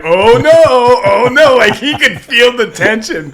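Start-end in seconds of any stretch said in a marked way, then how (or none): 0.0-1.6 son's worry. Retold or imitated. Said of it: "he was like".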